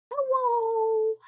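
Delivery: sing-song high greeting; high cheery hello. Real, not acted. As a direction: call hello brightly.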